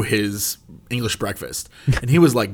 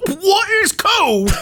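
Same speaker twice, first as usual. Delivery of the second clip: mock homeless guy; much slower than usual. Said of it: "homeless guy ... being like".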